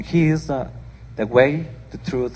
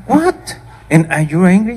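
high, loud astonished "What?"; shocked question; 8 semitones higher, 8 dB louder than usual. Retold or imitated. Aunt reacting to the kids' complaint, loud and astonished. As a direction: jump way up in disbelief. Her own shocked reply retold.

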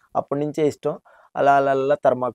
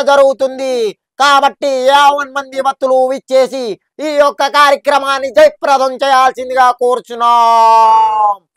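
loud, high, slow canvassing announcement; high, slow, shouted temple-festival announcement; street announcer impression; 8 semitones higher, 8 dB louder than usual. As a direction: boom it out like a town crier on a cycle loudspeaker. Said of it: "ఆయన voice ఎలా ఉంటుందంటే".

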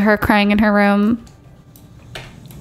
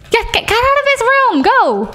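much higher exasperated command; scolding; 11 semitones higher than usual. Pretend. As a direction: shoo the kid out, shrill with impatience. Scolding game character to leave.

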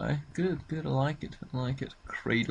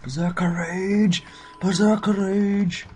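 slow, loud, higher battle cry; excited shout; 7 semitones higher, 8 dB louder than usual. Real, not acted. Warrior player shouting his ability, slow and loud. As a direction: bellow it like a war cry.

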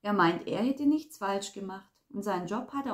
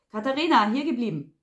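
loud, raised call to stop; commanding; 3 semitones higher, 8 dB louder than usual. A narrator's reading. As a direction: order someone to stay put, loudly. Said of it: "befahl Samuel". (befehlend, laut)

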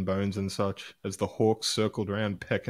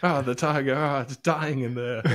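higher, lightly mock wildlife narrator; nature documentary; 5 semitones higher than usual. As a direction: play a lightly hammed zoo commentator. Mock zoo commentary continues.

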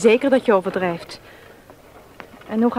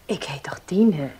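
whispered aside; 4 semitones lower than usual. Acted.

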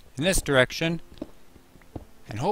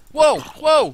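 much higher, loud startled yelp; startled shout; 14 semitones higher, 10 dB louder than usual. Real, not acted. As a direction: jump back in surprise. Sudden mob appears.

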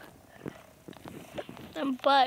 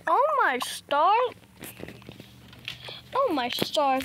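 ordinary talk

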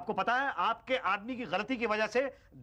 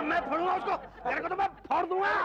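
angry threat; 11 semitones higher than usual. Acted. Beating official.

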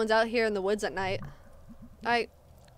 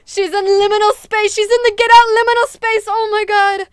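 high, very loud excited outburst; real high-pitched freak-out; excited yelling; 10 semitones higher, 13 dB louder than usual. Real, not acted. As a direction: yell it out at full volume. Player shrieks at what appears onscreen.

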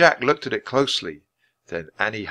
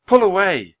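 louder, higher call to pull away; cry out; 10 semitones higher, 7 dB louder than usual. A narrator's reading. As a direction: urge him out of the dance. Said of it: "cried Annie".

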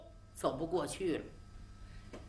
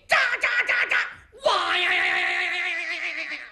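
war cry; 9 semitones higher, 4 dB louder, faster than usual. Acted. Battle cry while charging.

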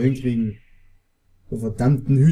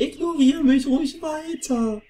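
mock offended; 10 semitones higher than usual.

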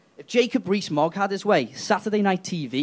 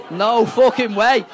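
usual speaking voice

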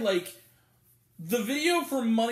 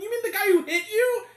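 high, loud, laughing disbelief; incredulous retort; 13 semitones higher, 5 dB louder than usual. Retold or imitated. Talking back to the mother, laughing in loud disbelief. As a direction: fire it back in shocked disbelief. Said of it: "I'm like".

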